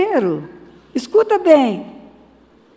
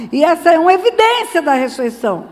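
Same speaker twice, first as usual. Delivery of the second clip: ordinary delivery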